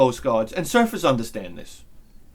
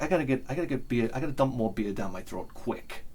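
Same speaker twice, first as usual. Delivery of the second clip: fast low and desperate; frantic hurried mutter; 4 semitones lower, 10 dB quieter than usual. Retold or imitated. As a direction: rush it, craving a beer. Desperate craving for beer.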